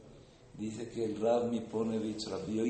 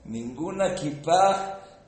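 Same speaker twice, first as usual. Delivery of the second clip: slow, loud, higher cry of wonder; drawn-out amazed exclamation; 6 semitones higher, 10 dB louder, much slower than usual. Real, not acted. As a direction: stretch it out in disbelief. Marvels that nobody wore a kipa.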